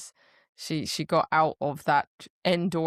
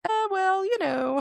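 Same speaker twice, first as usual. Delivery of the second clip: very high, hammed-up evasive voice; sheepish squeak; 14 semitones higher than usual. Caught-out queen answering angry guardian, high sheepish stalling. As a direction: squirm out a high-pitched non-excuse. Acts her squirming for an excuse.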